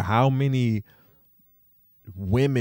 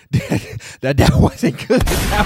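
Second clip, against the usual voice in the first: laughing; 13 semitones higher, 10 dB louder, slower than usual. Real, not acted. Laughing at the bad accent.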